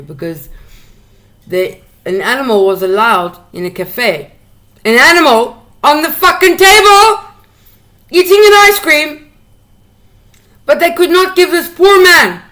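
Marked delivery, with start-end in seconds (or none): 4.8-12.4 high, loud indignant outburst; outraged. Real, not acted. Ranting about the dog fed before the poor man, outraged loud. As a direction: let the outrage burst out.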